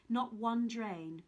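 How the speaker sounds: usual speaking voice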